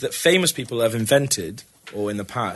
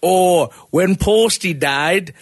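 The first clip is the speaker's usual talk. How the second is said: high, slow northern English voice; broad northern voice that slips towards Indian; northern accent; 5 semitones higher, 4 dB louder, slower than usual. Retold or imitated. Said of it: "when you try and do a northern accent, you sound Indian".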